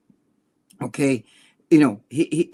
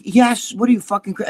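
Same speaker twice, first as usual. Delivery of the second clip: high-pitched acted exasperation; retold incredulous outburst; exasperated retort; 6 semitones higher than usual. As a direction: answer like it's blindingly obvious. Retold exasperated answer to friend.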